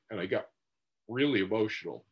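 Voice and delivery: ordinary talk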